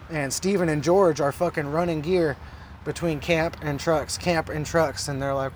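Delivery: ordinary delivery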